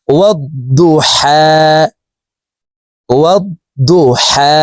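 ওয়াদ্দুহা with a doubled দ and a long final হা, slow; careful pronunciation. Shown as a demonstration. Teacher repeats the word for learners, slow and careful. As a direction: say ওয়াদ্দুহা slowly, ha from mid-throat. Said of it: "হলকের মধ্যখান থেকে উচ্চারণ করে পড়তে হবে".

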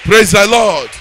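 rousing shout to the crowd; loud call. Real, not acted. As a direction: demand the response loudly. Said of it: "I say".

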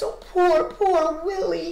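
lightly tearful pity for a friend; almost in tears. A narrator's reading. Alexander pities his doomed friend, almost in tears. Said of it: "Alexander was almost in tears".

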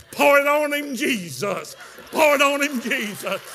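high loud cry, dropping on each "Jesus"; exhorting holler. Retold or imitated. Cook over the weeping man to Jesus, exhorting holler. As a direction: holler it like a fervent church woman. Cook cheering on God, acted.

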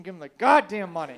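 loud exasperated demand; exasperated order. Retold or imitated. To his stubborn friend at gunpoint, exasperated order. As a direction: punch it out loudly, fed up with him.

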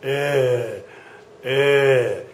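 "A" said as a rounded German ö; Ö sound demo. Shown as a demonstration. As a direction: say ö to a partner. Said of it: "Look at somebody and tell them".

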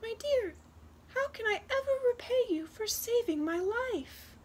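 high, sweet-talking little wren voice; little talking bird. Acted. Rescued wren thanks the girl who saved its life, high little bird voice. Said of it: "the little bird spoke".

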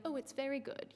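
soft, offhand polite praise; mock British understatement. Preacher mocking a polite British reaction, soft and understated. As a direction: say it like a reserved Brit sipping tea. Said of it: "like, a British thing".

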